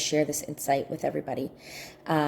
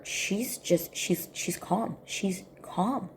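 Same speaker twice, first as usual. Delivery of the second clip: ordinary talk, nothing marked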